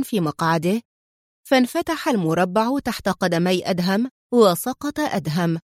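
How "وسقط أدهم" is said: ordinary delivery, nothing marked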